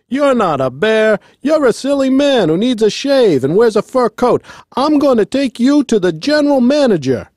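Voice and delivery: high-pitched angry foreman rant; very mad. A narrator's reading. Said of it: "He was very mad".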